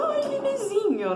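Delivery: very high, soft cooing; baby talk. Retold or imitated. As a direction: coo like to a newborn. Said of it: "falava com ele daquele jeito". (voz de bebê aguda e suave)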